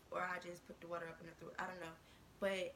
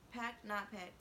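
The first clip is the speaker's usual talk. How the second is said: ordinary talk, nothing marked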